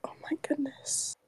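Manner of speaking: breathy shocked whisper. Real, not acted. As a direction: whisper it in disbelief. Shocked aside.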